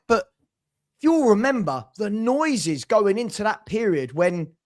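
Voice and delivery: ordinary delivery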